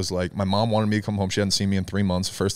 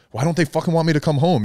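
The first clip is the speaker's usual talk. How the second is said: crying, upset; 8 semitones higher, 5 dB louder than usual. Retold or imitated. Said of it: "literally crying and shaking and being like".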